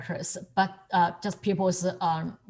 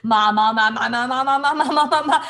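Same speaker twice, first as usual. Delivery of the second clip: imitating a baby's repeated "ma"; baby babble; 3 semitones higher, 5 dB louder, faster than usual. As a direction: babble "ma" like a toddler learning to talk. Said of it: "kids ... start to sound things".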